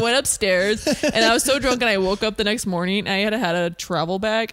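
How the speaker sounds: ordinary talk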